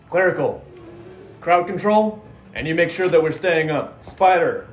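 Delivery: urgent commander's yell; yelled battle orders. Acted. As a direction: bark orders to the party mid-fight. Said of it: "Can I yell something as we see them?".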